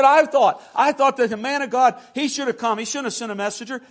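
loud, high outburst, easing toward the end; affronted complaint at pulpit volume; indignant Naaman. Acted. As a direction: protest as if personally slighted. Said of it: "watch what he says in wrath".

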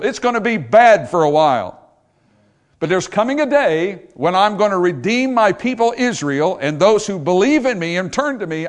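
preacher's voice swelling as God's promise; rising to a shout. Acted. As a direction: build to a ringing promise.

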